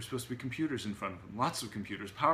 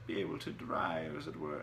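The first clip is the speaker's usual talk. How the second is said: mumbling digression; 4 semitones lower than usual. A narrator's reading. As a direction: lower pitch, drifting off. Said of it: "drifted off, as usual, into a mumbling technical digression".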